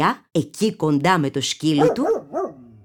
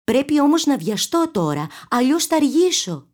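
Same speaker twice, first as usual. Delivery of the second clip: put-on girl voice; 8 semitones higher than usual. A narrator's reading.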